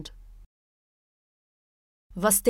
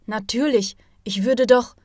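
rising heat, louder and higher; boiling over; 3 semitones higher, 7 dB louder than usual. A narrator's reading. Andreas snaps back at his teacher, boiling over. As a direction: Andreas flares up again, much louder. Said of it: "fing Andreas wieder an, überzukochen".

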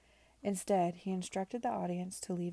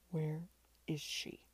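tight, halting restrained fury; through gritted teeth; 2 semitones lower, slower than usual. A narrator's reading. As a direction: force each word out, holding back anger. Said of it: "between gritted teeth".

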